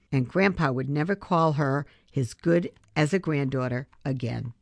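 usual speaking voice

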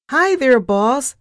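very high, loud, perky greeting; squeaked. A narrator's reading. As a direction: squeal hello like an excited little mouse. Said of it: "she squeaked".

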